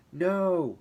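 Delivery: a high, loud cry of No; frightened shout. Acted. Brandon refusing John in terror, loud high cry. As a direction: fling out the refusal in panic. Terrified refusal before fleeing.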